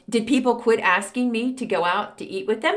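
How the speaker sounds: ordinary talk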